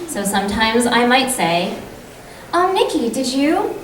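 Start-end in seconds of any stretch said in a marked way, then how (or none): 2.5-3.7 high, bright-up friendly opener; chatty to a friend. Shown as a demonstration. Actor warming up by greeting best friend Nikki, chatty. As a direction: call to your best friend before the line. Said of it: "imagine that I'm talking to my best friend".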